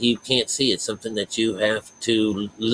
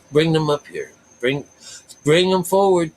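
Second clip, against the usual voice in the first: higher-pitched summons in God's role; God commanding; 7 semitones higher, 3 dB louder than usual. Acted. God summoning the doubters to come forward, commanding. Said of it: "God dealt with them. He says".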